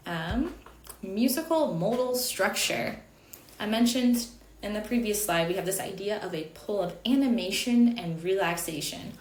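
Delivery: ordinary talk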